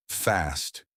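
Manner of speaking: plain reading voice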